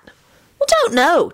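put-on high, drawn-out boyish annoyance; irritable. A narrator's reading. Timothy is pressed by Alex's question, irritable. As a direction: drag it out in peevish irritation. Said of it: "Timothy replied irritably".

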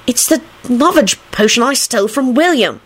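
put-on high, brazen girl's retort; high, indignant admission; unembarrassed. A narrator's reading. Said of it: "without the slightest vestige of embarrassment".

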